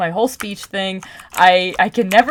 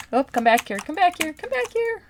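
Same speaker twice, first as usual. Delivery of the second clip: rising higher and softer each time; calling back; 8 semitones higher, 4 dB quieter than usual. Real, not acted. Calling back a wandering pet mid-video, climbing high and soft. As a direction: coax someone back in a climbing sing-song.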